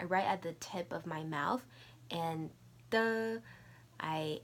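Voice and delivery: usual speaking voice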